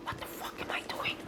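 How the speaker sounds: fast, voiceless whisper; whispered self-doubt. Retold or imitated. Man looking at the sword in his hand, quick whisper. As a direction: whisper it to yourself in sudden realisation. Inner thought on seeing sword.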